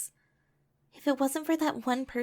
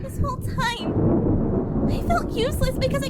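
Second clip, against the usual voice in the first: anguished cry; 12 semitones higher, 9 dB louder than usual. Acted. Aurora crying out to Louis that she felt useless, anguished. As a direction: let the hurt break out.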